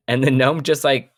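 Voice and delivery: ordinary talk, nothing marked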